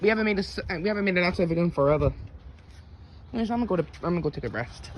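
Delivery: ordinary talk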